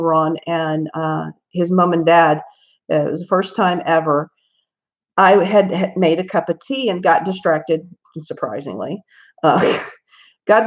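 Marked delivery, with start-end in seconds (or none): none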